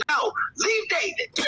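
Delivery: high, laughing mock shout; imitating a protest. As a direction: slowly cry it out through laughter, imitating a friend. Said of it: "And Sherry's like".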